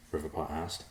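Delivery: ordinary delivery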